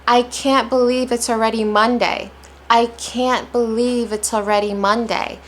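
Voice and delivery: "can't", "believe", "already" and "Monday" each lifted; stressed key words. Shown as a demonstration. Teacher starting a mini practice round, clearly stressed. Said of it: "I'm placing stress on".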